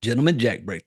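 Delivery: ordinary delivery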